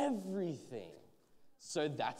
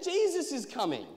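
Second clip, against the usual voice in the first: high, loud announcement; raised-voice herald's call; 11 semitones higher, 9 dB louder than usual. A narrator's reading. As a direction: shout the news down the street. Man announces news to the street.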